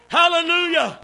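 high, loud shouted preaching; climbing sermon shout; preaching at full force. Acted. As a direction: whoop it up like a revival preacher.

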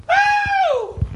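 high whooping cry of a freed lion; joyful whoop. Retold or imitated. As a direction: let out a wild joyful woo. Lion let free, imitated joy cry.

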